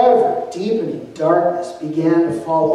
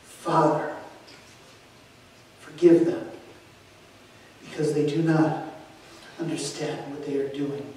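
struggling to breathe; 3 semitones lower, 7 dB quieter than usual. A narrator's reading. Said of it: "struggling to breathe".

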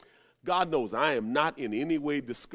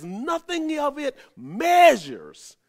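preaching shout; 9 semitones higher, 8 dB louder, slower than usual. Real, not acted. Preacher erupts at climax.

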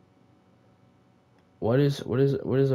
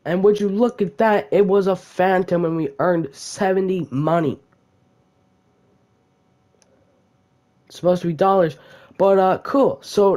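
excited; 6 semitones higher, 7 dB louder than usual. Real, not acted. Wins round, hypes result.

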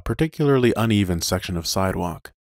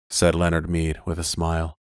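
plain reading voice

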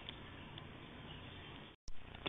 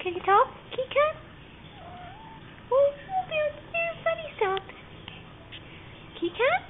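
pet talk voice; 11 semitones higher, 4 dB louder than usual. Real, not acted. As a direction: coax the cat to answer. Coaxing cat to talk.

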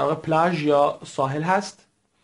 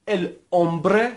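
slow model word; 2 semitones higher than usual. Shown as a demonstration. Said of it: "تکرار بفرمایید".

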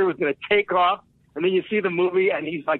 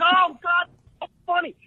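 mock laughing; 11 semitones higher than usual. The group cracking up, mimicked.

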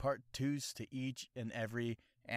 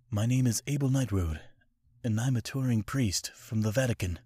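bold anime priest; 9 dB louder than usual. Retold or imitated. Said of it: "anime impression video".

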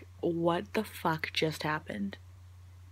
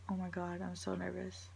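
softly nervous, under the breath; 10 dB quieter than usual. Real, not acted. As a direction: let the voice shrink with nerves.

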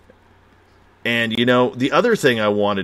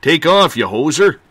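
hammed-up Canadian accent, higher pitched; 5 semitones higher, 5 dB louder than usual. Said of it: "There's a good Canadian accent".

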